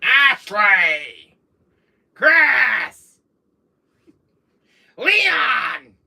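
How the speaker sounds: loud drawn-out name calls; hammed-up enraged roll call; angry Wesker. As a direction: bellow each name as a furious villain. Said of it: "When Wesker's more angrier".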